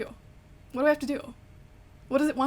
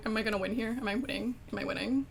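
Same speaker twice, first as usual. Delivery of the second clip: ordinary delivery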